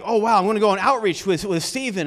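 a quick burst of imitated enthusiasm; eager. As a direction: rattle it off like an eager volunteer. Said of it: "I'm like".

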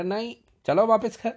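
high, loud insistence; stern scolding. Retold or imitated. Servant insisting to the boy he must not go, loud scolding. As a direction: lay down the rule sharply.

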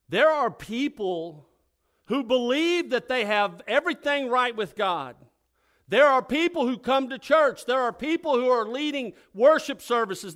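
loud, high, driving sermon voice; full-force preaching. Acted. Preacher warning the congregation with loud fervour.

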